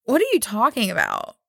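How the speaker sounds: rising incredulous what; baffled disbelief. Retold or imitated. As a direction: sound thrown by a strange remark. Baffled by doctor's tug-of-war remark.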